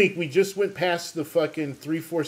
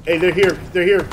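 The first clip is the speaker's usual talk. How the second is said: loud urgent callout; urgent shout; 3 semitones higher, 7 dB louder than usual. Real, not acted. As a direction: shout the warning to the squad. Enemies arrive, urgent callout.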